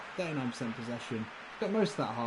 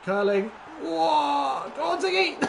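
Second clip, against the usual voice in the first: loud, rising excited cheer; excited shout; 10 dB louder, slower than usual. Real, not acted. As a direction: yell it out as the ball curls in. Excited goal call.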